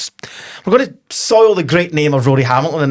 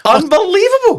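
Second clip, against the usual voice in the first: amazed. Real, not acted. Pundit reacting to a six three scoreline, amazed. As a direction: stretch it out in amazement.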